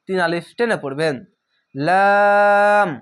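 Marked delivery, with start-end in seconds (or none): 1.7-2.9 লাম held long and slow; drawn-out letter name. Shown as a demonstration. Teacher showing students the long letter, drawn out. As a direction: stretch লাম three alif. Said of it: "এখানেও তিন আলিফ টেনে পড়বেন".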